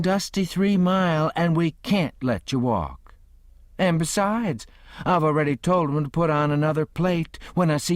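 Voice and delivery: a hint of an old Southern host inviting him in; kindly old gentleman. A narrator's reading. Old gentleman pressing guest.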